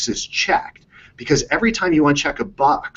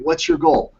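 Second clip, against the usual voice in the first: mock coach question; slower than usual. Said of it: "And they're like".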